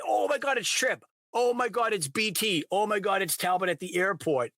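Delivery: high-pitched fan excitement; excited recognition. Retold or imitated. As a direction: gush as each favorite character turns up. Acting out delight at recognizing book characters.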